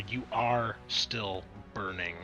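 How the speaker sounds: ordinary talk, nothing marked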